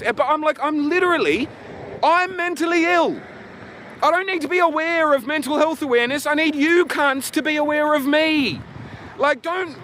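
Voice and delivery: worked-up rant. Real, not acted. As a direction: vent at full heat, voice climbing. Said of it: "Anyway, calming down".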